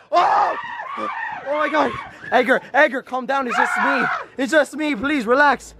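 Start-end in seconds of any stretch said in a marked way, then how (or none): 0.1-1.9 high, drawn-out frightened wail; panicked scream. Real, not acted. Friend panicking as the huge bird lunges at the dogs, drawn-out frightened wail. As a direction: cry out in panic as the bird attacks.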